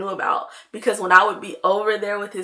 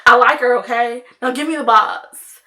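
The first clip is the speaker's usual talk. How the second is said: imitated outburst; 8 dB louder than usual. Brother blurts confession, demands box.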